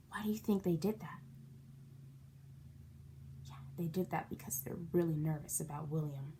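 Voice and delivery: fast, hushed whisper. Real, not acted. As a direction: whisper it like a secret. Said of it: "teacher whispers question to kids".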